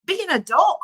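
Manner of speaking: high, loud rebuke; indignant demand; scolding. Real, not acted. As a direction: snap at them to grow up. Scolding the parents by name.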